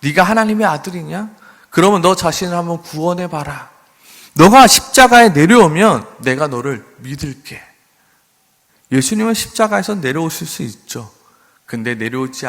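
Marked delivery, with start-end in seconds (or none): 0.0-7.6 higher, sneering dare growing louder; mocking challenge. Preacher voices mockers daring Jesus to save himself, scornful. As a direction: taunt him to come down. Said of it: "사람들은 말하죠".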